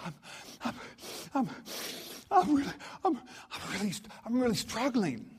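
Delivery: high, broken imitation of a tearful boy; imitated crying. Crying boy confesses to the preacher, mock sobbing. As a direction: stammer through mock sobs, pitch jumping up. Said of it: "he's just brokenhearted and he's crying".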